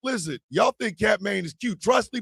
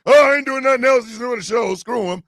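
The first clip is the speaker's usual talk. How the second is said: louder mock cat attitude at the start; voicing the cat; 4 semitones higher, 5 dB louder than usual. Owner voicing his cat's attitude, mock defiant. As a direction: give the cat a cocky dismissive voice. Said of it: "tearing the damn insulation like".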